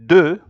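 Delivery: "de" said high and loud; teacher sounding out "de" after spelling it; French word "de". Shown as a demonstration. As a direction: say "de" clearly and loudly. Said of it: "the D E".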